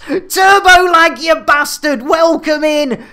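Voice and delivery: greeting a viewer who just joined, with a loud excited call. Real, not acted. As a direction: sing out the name to a new arrival. Streamer greets arriving viewer.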